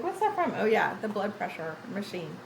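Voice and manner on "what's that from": surprised. Retold or imitated. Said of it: "I was like".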